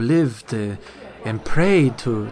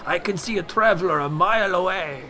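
merchant's boast; 6 semitones higher than usual. Acted. Merchant keeps speaking.

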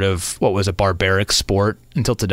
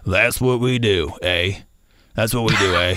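Canadian accent; 3 semitones lower than usual. Shown as a demonstration. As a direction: say it like a Canadian hockey guy. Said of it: "in a Canadian accent".

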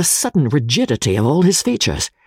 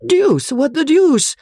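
exasperated Pesca; 13 semitones higher than usual. A narrator's reading. The flustered professor protests to his friend, high and sputtering. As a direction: sputter it out in dismay.

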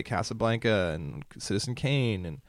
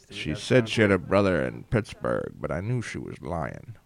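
noir detective; 5 semitones lower than usual. Acted. Noir narration resumed.

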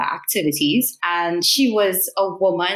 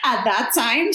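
laughing; 5 semitones higher than usual. Real, not acted. Laughing about mom's Law and Order.

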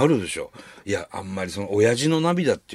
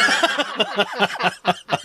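laugh; 8 semitones higher than usual. Real, not acted. Laughing at partner crying.

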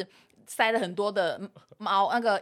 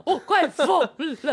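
higher, louder, slow, laughing frantic cry; telling the friend she is losing it, laughing despair; laughing mock despair; 5 semitones higher, 5 dB louder, much slower than usual. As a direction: laugh through a frantic complaint. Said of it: "然后我说".

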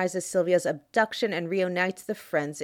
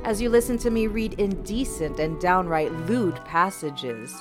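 sultry intro read. Acted. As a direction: purr it like a naughty invitation. Seductive podcast intro over music.

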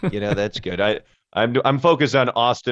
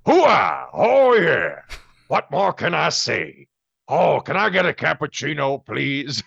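Pacino impression; 5 semitones higher, slower than usual. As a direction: bark it like a gung-ho soldier. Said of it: "but De Niro".

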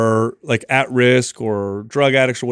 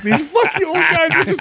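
high, laughing complaint; exasperated laugh at the Russian men; laughing exasperation. Retold or imitated. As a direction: laugh it out in disbelief. Laughing walking out of steam room.

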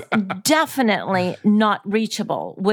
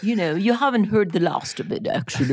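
lowered, mock English accent; put-on English accent; 3 semitones lower than usual. Co-host teasing Tom with her English accent, faintly contemptuous. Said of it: "her version of an English accent".

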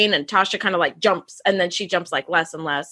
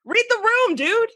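much higher, fed-up rant; mock exasperated scolding; 12 semitones higher than usual. Viewer scolding the commander to save the shuttlecraft, higher and exasperated. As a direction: scold her, pitched way up.

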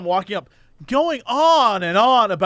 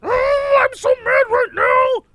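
shrill, high mocking whine; mock whiny anger; slower than usual. Mocking the angry drivers stuck in the garage, whiny high shout. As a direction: parody their tantrum, way up high. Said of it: "And they're like".

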